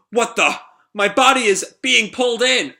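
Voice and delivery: high, loud startled exclamation; alarmed cry. Acted. Henchman caught in the gravity wave, alarmed cry. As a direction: cry out as if dragged helplessly.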